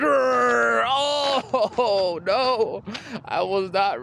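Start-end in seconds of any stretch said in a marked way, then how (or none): 0.0-2.8 mock pained cry. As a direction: cry out, then laugh. Mock pain after injury.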